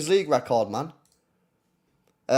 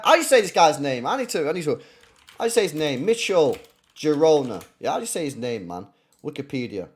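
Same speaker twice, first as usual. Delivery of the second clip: ordinary talk, nothing marked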